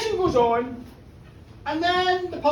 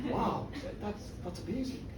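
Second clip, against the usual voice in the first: very soft, low amazement; hushed wonder; 5 semitones lower, 12 dB quieter than usual. Acted. [name] marvels at Peggy's granny's advice, hushed. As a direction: marvel quietly, barely above a murmur.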